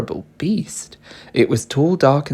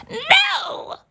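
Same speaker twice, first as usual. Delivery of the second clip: very high frightened cry; witch cries no. A narrator's reading. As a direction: panic in a high squeal. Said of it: "cried the witch".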